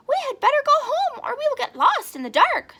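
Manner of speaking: high, loud fretful bird; worried bird. Acted. Flap urges them home, high and loud. Flap's voice in the story.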